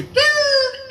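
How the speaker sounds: a sudden shrill high call of "Dirt"; sharp high yell. Retold or imitated. Husband mimics the warning call, high piercing yell. Mexican mom yelling alarm word.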